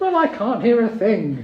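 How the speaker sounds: loud, high complaint; indignant mother. Acted. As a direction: retort loudly as the hard-of-hearing mum. Mother retorts to embarrassed son.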